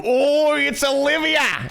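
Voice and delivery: high, drawn-out mock Australian squeal; shitty Australian accent. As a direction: put on a bad, squealing Aussie accent. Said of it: "the shitty Australian accent".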